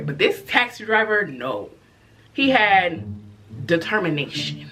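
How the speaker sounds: normal talking voice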